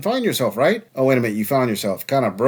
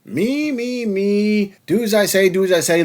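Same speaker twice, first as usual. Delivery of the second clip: mocking whiny me me me; 7 semitones higher than usual. Mocking a self-centred woman.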